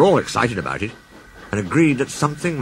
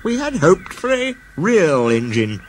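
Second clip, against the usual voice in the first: a higher, slow, careful engine voice; a big engine daring to object; Gordon's voice; 7 semitones higher, slower than usual. Acted. Gordon cautiously tells the Fat Controller their hope, slowly.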